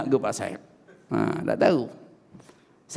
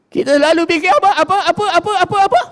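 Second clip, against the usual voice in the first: high, rising mock questions; preacher mocking worldly thoughts; 13 semitones higher, 3 dB louder, faster than usual. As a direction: tease the crowd with repeated apa. Mocking what people think about.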